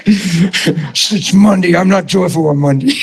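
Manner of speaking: very slow, sulky mock complaint, laughing; grumpy Monday mock. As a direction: drawl it like you dread Monday. Mocking grumpy Monday churchgoer.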